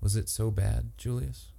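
tender hush to her husband; softly. A narrator's reading. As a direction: ask it gently, barely above a breath of voice. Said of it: "June said softly".

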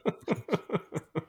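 high giggle; high-pitched laugh. Real, not acted. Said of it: "I'm sorry, I'm laughing".

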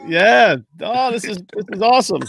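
ordinary talk

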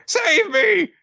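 very high, shrill plea for help; high panicked cry. Retold or imitated. Dosan calling out to Kanbei, high panicked cry. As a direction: shriek for help like a coward.

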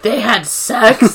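slow mock-stunned question; lightly acted shock. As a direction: imitate disbelief, stretching the words. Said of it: "She's completely shocked".

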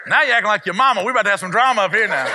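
high, loud sassy telling-off; mock scolding. Dad telling off his fry-hoarding daughter, mock scolding. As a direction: play up the outraged parent for laughs.

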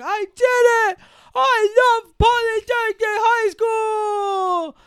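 very high, loud, drawn-out triumphant shout; elated yelling. Retold or imitated. Girl learns she passed high school, elated yelling. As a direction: yell it out in hammed-up triumph.

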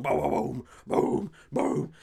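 comic vocal shotgun booms; imitated shotgun blasts. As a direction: punch out separate gun blasts with the voice. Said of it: "dudes with shotguns just going".

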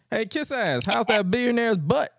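high, loud mocking heckle; taunting. APA taunting Regal for kissing Vince, mocking jeer. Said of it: "was taunting William Regal".

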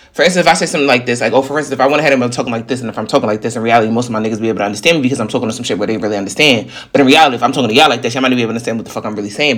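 rapid-fire slick New Yorker talk; very fast, jumbled everyday chatter. Shown as a demonstration. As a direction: rattle it off at double speed, words run together. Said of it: "I talk fast".

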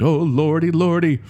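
high, loud frightened moan; terrified moan. Acted. Gravedigger moaning in terror as the ghost enters. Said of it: "the gravedigger moaned".

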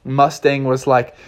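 ordinary delivery

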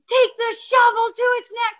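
high-pitched retold command; imitating a frantic instruction; urgent order. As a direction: reenact ordering him urgently.

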